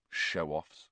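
low, soft mutter. A narrator's reading. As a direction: mutter it under your breath. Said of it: "Ron muttered".

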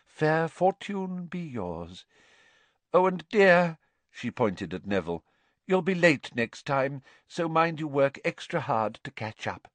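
high, theatrical fortune-teller voice; airy seer. Acted. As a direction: mystic professor dismissing the class.

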